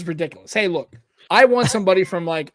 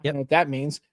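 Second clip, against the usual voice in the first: ordinary delivery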